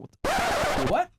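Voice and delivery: squeaky high double take; shocked. Acted. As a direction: recoil in disbelief, voice jumping up.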